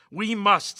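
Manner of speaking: loud, high, insistent; strident. A narrator's reading. Meagle insisting to Barnes, strident and loud. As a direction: cut him off with force. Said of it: "in strident tones".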